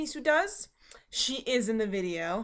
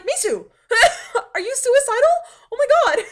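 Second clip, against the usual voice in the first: high, loud words broken by laughter; laughing; 12 semitones higher, 11 dB louder than usual. Real, not acted. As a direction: laugh it out in disbelief at the cat. Laughing at cat in bowl.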